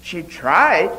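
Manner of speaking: ordinary delivery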